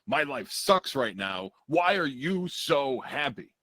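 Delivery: threatening. Acted. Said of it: "like, in a threatening".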